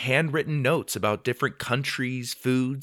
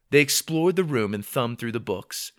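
ordinary delivery, nothing marked

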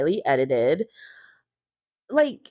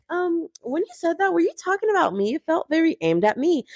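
high, fast, whiny Teresa voice; mock Teresa; 7 semitones higher than usual. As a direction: imitate her playing innocent. Mocking Teresa's question.

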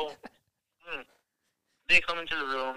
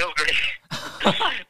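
louder, higher, dry "Oh, great."; sarcastic; 4 semitones higher, 5 dB louder than usual. Retold or imitated. Answering the escorts' warning, sarcastic and louder. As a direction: throw it back with irony. Sarcastic reply to "don't end up like us.

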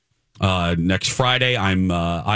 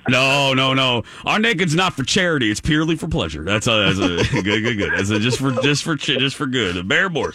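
put-on manly caller, pitched up; 3 semitones higher than usual. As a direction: play an eager prank caller in a raised manly voice. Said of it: "that was my manly voice".

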